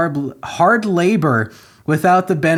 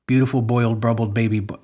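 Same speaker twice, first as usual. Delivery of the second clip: ordinary talk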